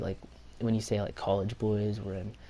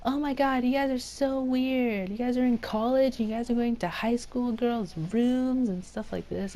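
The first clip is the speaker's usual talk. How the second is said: mock yell, pitched way up without real volume; imitated yelling girl; 12 semitones higher than usual. Girl scolding college boys in the hotel, high mock yelling. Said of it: "like, yelling at us".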